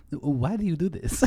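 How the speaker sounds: put-on puzzled Italian elder, comic accent; mock Italian accent. Grandfather questioning his actor grandson, mock Italian accent. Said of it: "And that's the Italian accent".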